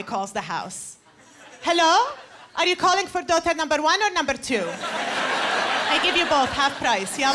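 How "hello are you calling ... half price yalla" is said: eager dad. Retold or imitated. Dad pushing his daughters on any guy who calls. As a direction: sell the daughters to the caller.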